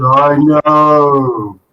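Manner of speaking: long, eerie ghost wail; ghostly voice. Acted. The rabbit's ghost answering the barman, eerie. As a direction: drag it out like a moaning ghost. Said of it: "That's a ghostly voice".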